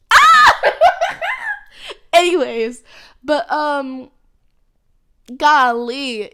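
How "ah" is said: sudden high shriek into a laugh; squealing laugh. Real, not acted. Excited squeal over graduating. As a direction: squeal with giddy delight.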